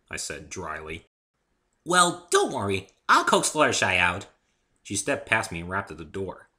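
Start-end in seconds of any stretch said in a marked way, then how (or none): 1.8-4.3 high, loud, bouncy pony voice; high loud cheerful pony girl; bubbly Pinkie. A narrator's reading. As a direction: chirp it like an excitable pony.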